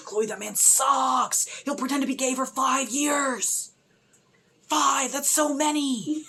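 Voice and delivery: high, slow pleading call; pleading with the screen. Real, not acted. Fan pleading with Chloe on screen, high and slow. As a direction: beg her to see the truth.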